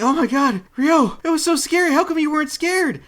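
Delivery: high, hammed-up frightened girl voice; hammed-up scared Yuri, thanking her rescuer; mock scared girl. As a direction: squeak it like a scared young woman.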